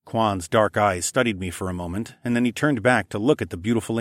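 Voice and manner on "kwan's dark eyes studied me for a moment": ordinary delivery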